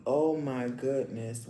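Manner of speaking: normal talking voice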